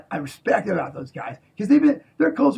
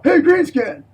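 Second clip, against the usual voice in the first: high, loud jeering call with a laugh; mocking taunt; 9 semitones higher, 8 dB louder, slower than usual. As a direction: heckle him like a schoolyard bully. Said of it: "someone would be all".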